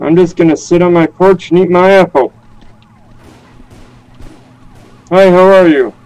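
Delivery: lightly voiced confused grandpa; old man voice. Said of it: "the confused old man around the server".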